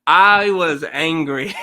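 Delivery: loud, emphatic recalled anger; angry recollection. Retold or imitated. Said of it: "I posted a very angry post".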